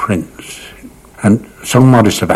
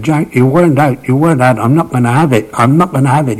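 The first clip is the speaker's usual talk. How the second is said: put-on higher, insistent boyish protest; indignant protest; 6 semitones higher than usual. Young journalist disputing the umpire's decision, indignant. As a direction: imitate someone refusing to accept it, pitched up.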